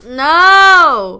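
loud, high no; loud protest. Real, not acted. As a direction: shout the refusal. Protesting cohost mentioning.

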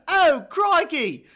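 high, slow, hammed-up Australian exclaiming; Australian bloke. Aussie bloke spotting the cage, high mock Australian surprise. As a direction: play an Aussie caught off guard, drawn out in an Australian accent. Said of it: "that's Australian right there".

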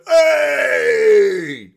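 imitating a long, high workmen's holler; shouted cheer. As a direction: bellow it as a recognising cheer. Retelling workmen cheering at him.

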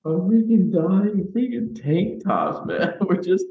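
can't stop laughing while talking. Real, not acted. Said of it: "let me compose myself".

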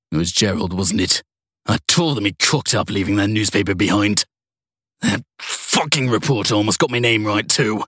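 understated furious outburst at being caught; raging. A narrator's reading. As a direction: let the anger simmer, not boil over. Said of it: "Whiteley raged".

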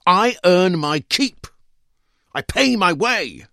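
high, loud, slow indignant retort; every word pressed out, offended; indignant wife. A narrator's reading. Wife's remembered retort quoted.

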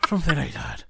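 deep, gruff mock smoker voice; Liam Neeson voice. Joking about the actor's smoking, deep gruff imitation. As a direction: drop very low and put on the gruffness. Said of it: "You gotta do it gruffer".

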